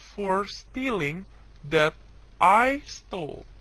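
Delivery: slow, stressed contrast words; contrast the forms. Shown as a demonstration. Teacher contrasting the two forms slowly. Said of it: "Kita bisa lihat di sini ya".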